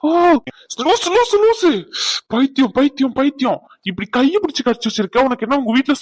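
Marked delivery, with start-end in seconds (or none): 0.1-3.6 mock yelp and scolding. Reenacting being bitten, yelling.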